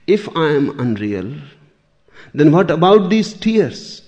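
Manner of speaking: acted protest through tears, high and loud; tearful protest. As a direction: plead loudly, without real sobbing. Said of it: "Alice goes on crying and says".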